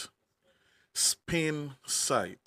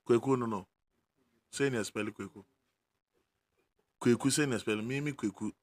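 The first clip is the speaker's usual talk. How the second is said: ordinary delivery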